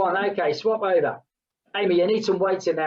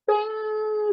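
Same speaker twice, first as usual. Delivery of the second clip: high bing sound. Real, not acted. Coach marking the end of the set, a high bing. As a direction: imitate a timer bell, very high.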